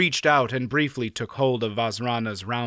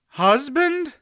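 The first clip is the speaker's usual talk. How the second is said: high, shocked yelp of a word; a nervous boy caught off guard; faltering squeak; 4 dB louder than usual. A narrator's reading. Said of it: "in a faltering voice".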